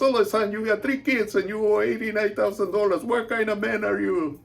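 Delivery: shouting, high and loud, at her husband; furious wife. Retold or imitated. Wife yelling at her husband over hidden kids, furious shout. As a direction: tear into him over the lies. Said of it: "she was so pissed".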